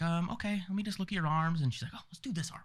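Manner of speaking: high, sing-out nurse impression; coaxing nurse. Retold nurse coaxing the patient.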